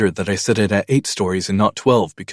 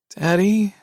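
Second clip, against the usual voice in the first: put-on much higher, a small scared child's voice; frightened little girl; 13 semitones higher than usual. A narrator's reading. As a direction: sound like a little girl afraid of thunder. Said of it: "she says in a whisper".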